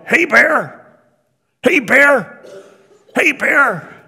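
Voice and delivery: high, loud repeated shout imitating a camper; retold yell. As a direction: yell it out in bursts, as if warning off a bear. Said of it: "I hear somebody yell".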